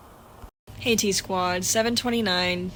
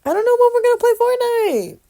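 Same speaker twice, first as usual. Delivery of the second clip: much higher, louder whine; whiny sing-song; 13 semitones higher, 5 dB louder, faster than usual. Real, not acted. Girl whining about when they'll play Fortnite, high whine.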